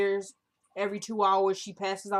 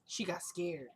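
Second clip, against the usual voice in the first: quiet remark; 3 semitones lower, 11 dB quieter, slower than usual. Real, not acted. Same remark repeated for emphasis.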